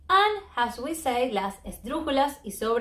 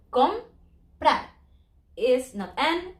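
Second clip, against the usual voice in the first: ordinary talk, nothing marked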